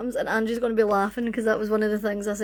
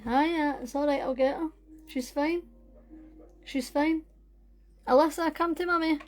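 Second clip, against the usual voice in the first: mummy voice; 6 semitones higher than usual. Real, not acted. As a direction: coax your little one over gently. Talking to her little girl.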